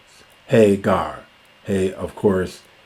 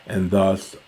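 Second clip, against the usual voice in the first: normal talking voice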